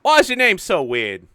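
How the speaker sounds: put-on high, loud cheeky child voice; cheeky girl. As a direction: needle him like a bratty kid. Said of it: "And she's like".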